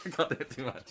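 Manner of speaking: normal talking voice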